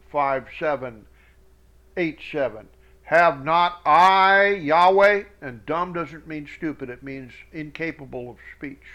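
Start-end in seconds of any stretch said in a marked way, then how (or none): 3.0-5.2 slow, weighty reading of God's words; solemn scripture reading. Acted. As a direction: read it like a preacher, slowly. Reads God's words aloud solemnly.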